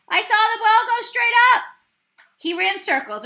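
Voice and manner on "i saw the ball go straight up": high, loud excited shout. A narrator's reading. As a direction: yell it to the whole field. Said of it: "the catcher shouted".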